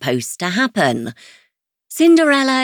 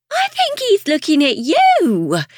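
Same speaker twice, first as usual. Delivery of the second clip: high, amazed voice; can't believe what she sees; disbelief; 11 semitones higher than usual. Acted. Chloe in disbelief.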